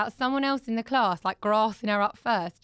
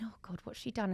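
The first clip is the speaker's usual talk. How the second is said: retold inner dread under the breath; very soft, whispered aside; whispered thought; 10 dB quieter than usual. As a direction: whisper it like a private worry. Retells her anxious private thought.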